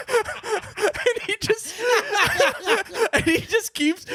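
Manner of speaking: high squealing laughter breaks through the words; cracking up while telling it; laughing hard. Real, not acted. Telling friend about dad's hug, laughing hard.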